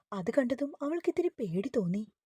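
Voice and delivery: plain reading voice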